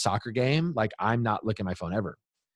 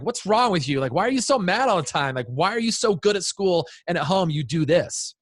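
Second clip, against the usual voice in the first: higher-pitched, exasperated dad's outburst; retold freak-out; 7 semitones higher than usual. Dad confronting his daughter after slamming the door, retold freak-out. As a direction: re-enact laying into your kid, worked up. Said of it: "I slammed the door and I freaked out".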